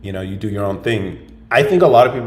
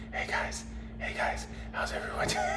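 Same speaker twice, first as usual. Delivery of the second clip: breathy whispered ASMR greeting; mock ASMR whisper; 12 dB quieter than usual. Shown as a demonstration. Streamer trying out an ASMR whisper for chat, whispered. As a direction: whisper it like an ASMR channel intro. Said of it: "I can't do it".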